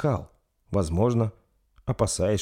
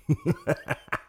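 shrill high cackle; high vampire laugh; 3 dB quieter than usual. Acted. Vampire laughs after feeding.

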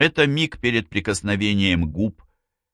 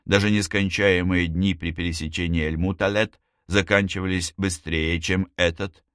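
plain reading voice

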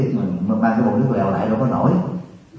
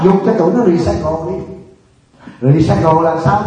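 raised, insistent sermon voice; scolding monk laying into the crowd, preaching at full force; fiery preaching; 6 semitones higher, 5 dB louder than usual. Acted. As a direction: preach hard at the congregation, scolding.

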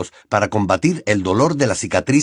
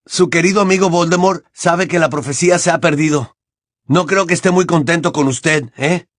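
lightly acted taunt, pitched up; 7 semitones higher, 3 dB louder than usual. Harry mocking Bellatrix about Voldemort's displeasure, taunting. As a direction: needle the enemy with raised pitch.

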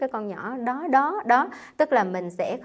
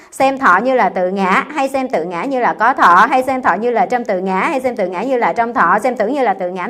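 slow, measured chanting of scripture, a bit higher and louder; solemn scripture reading voice; sutra recitation; 3 semitones higher, 10 dB louder, slower than usual. Acted. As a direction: intone the sutra deliberately, word by word. Reciting sutra text to class.

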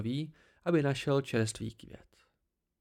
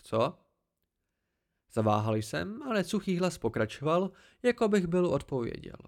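higher, smug voice of the hidden Cthaeh; haughty tree creature; 4 semitones higher than usual. Acted. The Cthaeh corrects the boy, higher and smug. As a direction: talk down to the boy from the branches, pleased with yourself.

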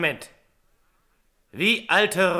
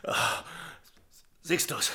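ordinary talk, nothing marked

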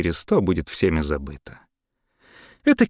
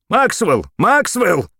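calling loud and high into the radio; shout; 5 dB louder, slower than usual. A narrator's reading. Mayor shouting for Maxwell into the radio. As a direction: yell for Maxwell over the radio. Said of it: "крикнул он".

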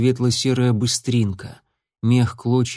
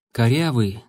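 ordinary delivery, nothing marked

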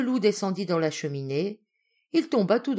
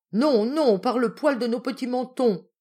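defiant refusal; 4 semitones higher, 5 dB louder than usual. Acted. Pigs refuse the wolf.

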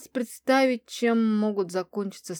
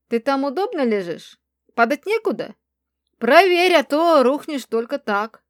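higher-pitched teasing, getting louder; snide inquiry; 4 semitones higher, 8 dB louder than usual. A narrator's reading. Friend mocks her for lying down, snide. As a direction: needle her with mock concern. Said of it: "подруга ехидно осведомилась".

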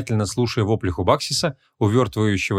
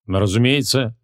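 plain reading voice

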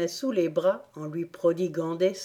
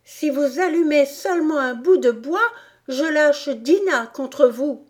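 higher and louder, a shouted warning; shouted threat; 8 semitones higher, 5 dB louder than usual. A narrator's reading. Alice threatening the animals, shouting. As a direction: yell it out to scare them off. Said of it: "qui se mit à crier de toutes ses forces".

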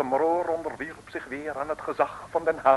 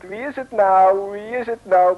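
pestering question; 6 semitones higher, 9 dB louder than usual. Acted. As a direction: keep begging to know who it is.

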